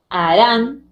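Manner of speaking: "Harán" with the voice's force on RÁN; stress on last syllable. Shown as a demonstration. Teacher showing the class a tonic syllable, "Harán" stressed on RÁN. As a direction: push hard on the final syllable of "Harán". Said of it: "Donde pongo mayor fuerza de voz".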